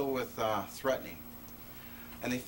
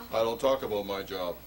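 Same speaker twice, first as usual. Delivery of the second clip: lower, closed-off guard; curt guard; 8 semitones lower than usual. Retold or imitated. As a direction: shut the topic down as the guard. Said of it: "guard's reply in retold exchange".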